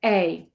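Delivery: "a" gliding as ay, two vowels in one; diphthong demo. Shown as a demonstration. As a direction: slide "a" from one vowel into another. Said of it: "It could be a diphthong".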